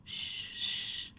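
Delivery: soft shushing; gentle whispered shh. Acted. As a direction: hush a crying child. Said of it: "her mother whispered".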